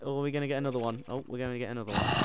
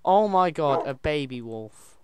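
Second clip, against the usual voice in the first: delighted surprise; 2 semitones higher, 8 dB louder, slower than usual. Real, not acted. Player spots a baby wolf, delighted surprise.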